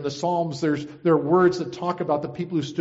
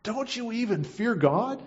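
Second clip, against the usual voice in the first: higher, slow reproach; rebuking; 8 semitones higher than usual. A narrator's reading. Other criminal rebuking the first, reproachful. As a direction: draw out an incredulous challenge. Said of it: "rebuking him".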